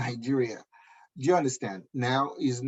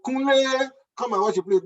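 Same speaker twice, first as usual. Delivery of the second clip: wife calling out; 12 semitones higher, 7 dB louder than usual. Retold or imitated. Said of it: "She was like".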